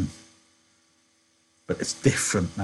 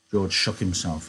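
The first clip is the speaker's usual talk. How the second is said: usual speaking voice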